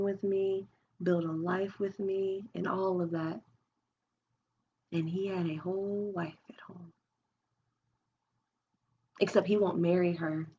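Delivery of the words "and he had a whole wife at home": sudden hushed whisper; whispering the painful truth. Real, not acted. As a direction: drop to a secret whisper. Whispered reveal in confession.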